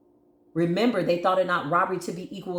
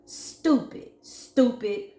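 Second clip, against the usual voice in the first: high breathy whispered jeer; scornful; 5 semitones higher, much slower than usual. Real, not acted. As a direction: hiss it up high in contempt. Scornful repeated insult.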